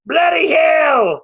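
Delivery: high shouted British cry of pain; British yelp of pain. Retold or imitated. Punched actor cries out in pain, British shout. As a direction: howl it like a hit Brit. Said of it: "for him to go".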